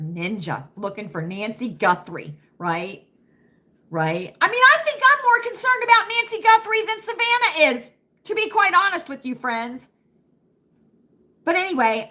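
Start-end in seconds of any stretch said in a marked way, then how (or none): 4.4-9.9 emphatic outburst. Real, not acted. Emphatic concern rant.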